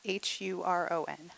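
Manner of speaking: letter by letter, slow and soft; spelling it out. Shown as a demonstration. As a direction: spell out H U R O N one letter at a time. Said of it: "Can you spell that?".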